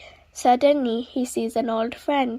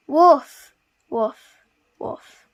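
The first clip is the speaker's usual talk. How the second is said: loud barked woof; loud dog bark; 7 dB louder, much slower than usual. Acted. Dog friend barks loudly.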